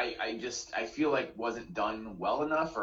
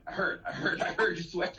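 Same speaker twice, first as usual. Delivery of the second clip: higher voice breaking into laughter; laughing; 7 semitones higher than usual. Real, not acted. Movie fan retelling the preacher's line, laughing. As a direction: quote through real giggles.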